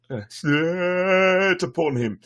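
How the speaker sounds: slow words through a yawn; stifled yawn. Real, not acted. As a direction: hold back a yawn while reading. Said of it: "I covered another yawn".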